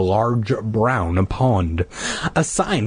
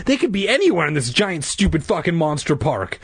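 exasperated sigh; 7 semitones higher than usual. Acted. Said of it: "I sighed".

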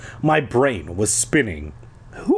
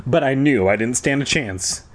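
ordinary delivery, nothing marked

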